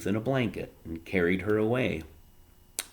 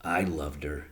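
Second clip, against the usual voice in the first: quiet grief; 5 semitones lower, 5 dB quieter than usual. A narrator's reading. Grieving father repeats.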